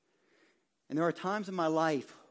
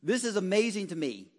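usual speaking voice

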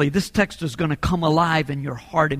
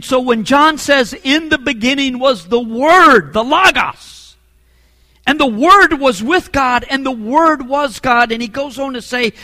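loud, higher-pitched proclamation of scripture; emphatic preaching; 7 semitones higher, 6 dB louder than usual. Real, not acted. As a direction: proclaim it forcefully to the congregation.

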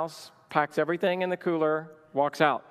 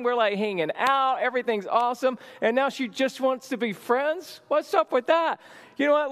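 upset crying prayer; 8 semitones higher than usual. Retold or imitated. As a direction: wail at God in heartbreak. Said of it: "I'm crying".

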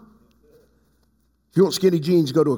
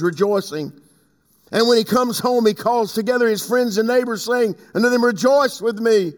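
raised, declamatory Bible reading; preached scripture reading; 4 semitones higher than usual. Acted. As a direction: proclaim the verses to the congregation. Reads scripture aloud, preaching.

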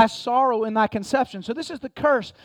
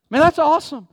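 higher, louder joyful burst; excited exclamation; 4 semitones higher, 5 dB louder than usual. Real, not acted. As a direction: cry it out with delight. Preacher exclaims about heaven.

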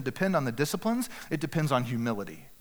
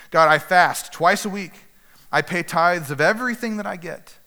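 pompous boast; 5 semitones higher, 8 dB louder than usual. Acted. Pharisee boasting loudly to God of his fasting, proud. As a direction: puff up and announce your piety.

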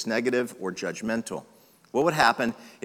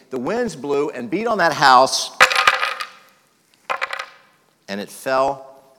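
building preached warning; 4 semitones higher, 5 dB louder than usual. Acted. Pastor to congregation, the foolish builder, building loud. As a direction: preach the house falling, building louder.

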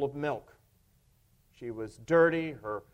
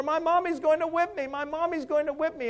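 put-on high wailing child's voice; crying child; 11 semitones higher than usual. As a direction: sob it out like a frightened girl. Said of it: "began to cry all the louder".

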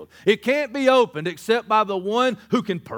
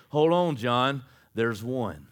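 steady him with a lowered voice; calm reassurance; 8 semitones lower, slower than usual. Retold or imitated. Elder reassuring the apostle from beside the throne, calm.

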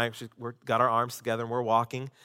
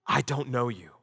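solemn verdict; 3 semitones lower, 4 dB quieter than usual. Acted. Jesus tells the false followers on judgment day, grave. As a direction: hand down the sentence with weight.

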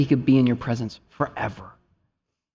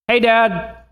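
put-on little boy calling; 8 semitones higher, 4 dB louder than usual. Son calling from bedroom.

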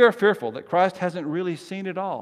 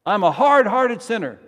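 loud, high-pitched line for response; forceful confession, projected high; emphatic call; 6 semitones higher, 9 dB louder than usual. Acted. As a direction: call it out for the congregation to answer. Leading congregation in call and response.

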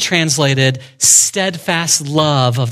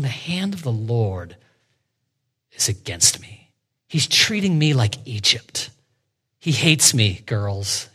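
quiet bitterness; 6 semitones lower, 6 dB quieter than usual. Retold or imitated. Voicing bitter Naomi's lament.

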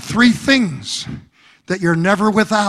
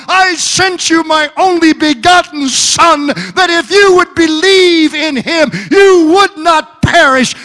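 higher, louder, crying preacher's voice; preaching at full force; 6 semitones higher, 4 dB louder than usual. Acted. Preacher speaking God's promise to the church, full-force preaching. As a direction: proclaim it over the congregation.

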